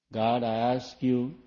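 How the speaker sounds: slow, drawn-out humble plea; slow pleading prayer. Shown as a demonstration. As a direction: ask God slowly, like a meek beggar. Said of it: "you know how they ask?".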